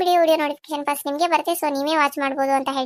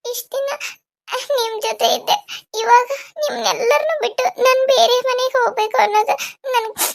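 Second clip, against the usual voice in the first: high, halting crying voice; tearful sobbing; 6 semitones higher than usual. Acted. Bride pleading to her mother about leaving home, sobbing. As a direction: sob between phrases, dragging out the last plea. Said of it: "ಯಾಕ್ ಅಳ್ತಿದಿ".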